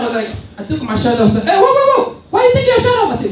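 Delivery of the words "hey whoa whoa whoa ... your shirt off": high, loud mock alarm; startled girl. Girl seeing him remove his shirt, high loud alarm. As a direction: squawk in alarm as the shocked girl. Startled girl's reaction acted out.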